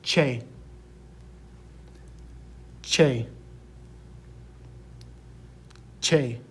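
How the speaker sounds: Che said CHAY, falling, spaced apart; slow model pronunciation. Shown as a demonstration. Teacher has students repeat the letter after her, clear demonstration. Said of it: "Repeat after me".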